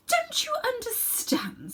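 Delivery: high, slow whispered disdain; queen's whisper. A narrator's reading. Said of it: "said the Queen".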